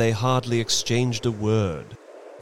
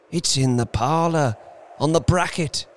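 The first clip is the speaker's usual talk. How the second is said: higher, puzzled reply; marvelling; 7 semitones higher than usual. A narrator's reading. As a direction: answer in wonder at being asked.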